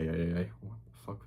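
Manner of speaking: normal talking voice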